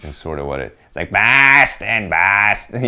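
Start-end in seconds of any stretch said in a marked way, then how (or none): 1.1-2.8 angry-duck Boston accent; mock Boston accent, like an angry duck. Shown as a demonstration. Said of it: "some version of an angry duck".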